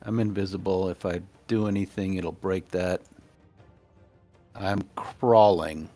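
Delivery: ordinary talk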